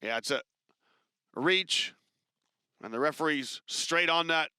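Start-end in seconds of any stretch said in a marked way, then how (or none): none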